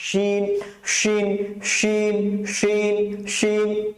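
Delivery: শিন drilled with a thick, full "sh"; full-mouthed shin. Shown as a demonstration. Teacher drills students after explaining, thick full শিন. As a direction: fill the mouth with each sh of শিন. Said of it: "এত করে আওয়াজ করবেন".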